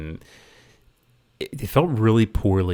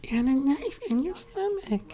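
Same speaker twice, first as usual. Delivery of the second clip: mocking quote; 7 dB quieter than usual. Mocking the film's heroine.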